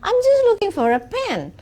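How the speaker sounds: high, nervous excuse; a bit scared. Acted. Child caught in mother's room, slightly scared. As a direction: answer timidly, caught out. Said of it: "dengan sedikit ketakutan".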